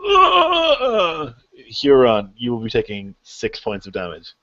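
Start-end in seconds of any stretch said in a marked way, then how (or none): 0.1-1.3 high, loud, drawn-out pained yell; acted cry of pain. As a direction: cry out as if struck by a chainsaw. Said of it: "and you hear a".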